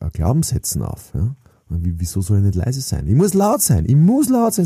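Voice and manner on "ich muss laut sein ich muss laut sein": higher and louder, proving his point; defiant loud declaration; loud insistence. Acted. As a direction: say it big, like you mean to be heard.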